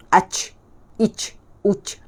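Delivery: "Ach, ich, uch" with voiceless ch; wrong jim sound. Shown as a demonstration. Teacher repeats the mistake, airy "Ach, ich, uch". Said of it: "Bunyi macam tu".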